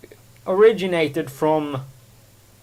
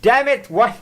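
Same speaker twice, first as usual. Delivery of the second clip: sharp loud burst of annoyance; frustrated outburst; 8 semitones higher, 6 dB louder than usual. Real, not acted. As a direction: snap at yourself for the slip. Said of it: "the word I'm looking for".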